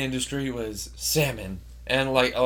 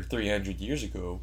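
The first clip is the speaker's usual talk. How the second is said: slight Canadian lilt on the vowels; faintly Canadian-sounding retold quote; Canadian accent; 6 semitones lower, 9 dB quieter than usual. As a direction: let a hint of Canadian creep in. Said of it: "you just said it in a Canadian accent".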